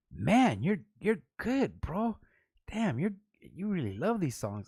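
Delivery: swooping, high-pitched amazed praise; impressed karaoke DJ. Retold or imitated. Karaoke DJ praising a surprise rapper, imitated amazed gushing. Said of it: "the fucking D J would be like".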